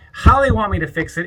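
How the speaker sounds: ordinary delivery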